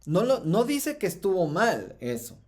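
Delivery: ordinary talk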